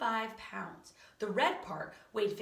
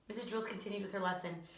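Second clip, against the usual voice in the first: ordinary delivery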